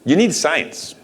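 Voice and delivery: ordinary talk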